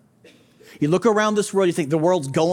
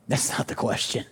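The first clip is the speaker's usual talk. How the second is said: hushed aside; 7 semitones lower, 7 dB quieter than usual. Real, not acted. Preacher drops to aside.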